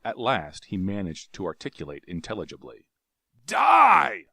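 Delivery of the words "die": high, loud furious shriek. Acted. Tario shrieking death at his enemies. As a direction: shriek the sentence. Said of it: "he shrieked".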